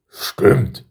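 lightly voiced grumbly son; one-word grunt of agreement; Berthold. A narrator's reading. Son agreeing with his father the king, mildly grumbly. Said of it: "mit seiner brummigen Stimme".

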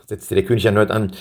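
plain reading voice

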